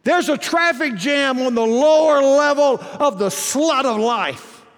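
loud, high pulpit preaching with stretched key words; full-force preaching. Real, not acted. Preacher urging the congregation onto the high road, full-force preaching. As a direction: preach it out to the whole room.

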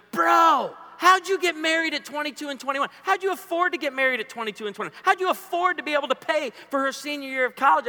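high-pitched, astonished bro voice; incredulous young guy. Retold or imitated. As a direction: imitate a college kid baffled, quick and high. Acting out astonished young college guys.

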